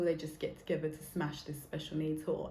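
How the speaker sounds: ordinary talk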